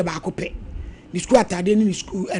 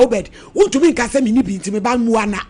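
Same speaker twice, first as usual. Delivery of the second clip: normal talking voice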